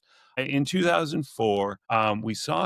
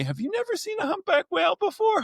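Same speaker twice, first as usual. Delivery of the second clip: much higher-pitched mock-astonished question; incredulous questioner; faster than usual. Passenger questioning the over-excited whale guide, mock incredulous. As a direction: imitate a baffled onlooker, pitched way up. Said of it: "someone says to me".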